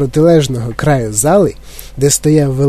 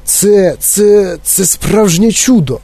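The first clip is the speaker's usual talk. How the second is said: amazed, halting, higher-pitched; Дідунь Джо; 5 semitones higher, slower than usual. A narrator's reading. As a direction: stumble into a slow, drawn-out exclamation of wonder. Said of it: "роззявив рота дідунь Джо". (вражено, затинаючись, протяжно)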